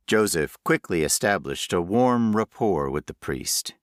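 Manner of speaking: usual speaking voice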